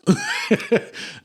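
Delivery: high burst of laughter. Real, not acted. Laughing at hiccup.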